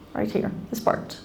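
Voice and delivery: breathy whisper, pointing out a spot. Real, not acted. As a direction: drop to a whisper for the secret. Pointing to the eye area.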